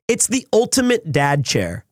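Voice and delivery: normal talking voice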